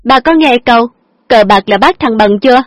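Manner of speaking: high, loud reproach; heated lecturing. A narrator's reading. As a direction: drive the point home angrily. Daughter lectures father.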